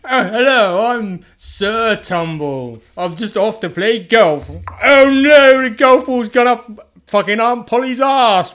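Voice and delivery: high, loud, slow plummy posh-twit voice; posh Sir Tumble. Retold or imitated. Dad imitating the posh TV character, loud and plummy. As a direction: ham up a pompous posh gent off to golf. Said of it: "He's proper like".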